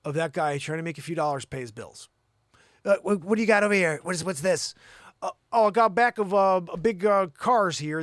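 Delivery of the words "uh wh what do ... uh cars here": loud, raised yard-sale dealer voice; pushy haggler act. Acted. Haggler checks out boxes of cars, loud pushy act. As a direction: call it out loud over the table.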